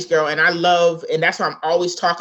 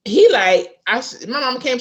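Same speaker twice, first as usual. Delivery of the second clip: normal talking voice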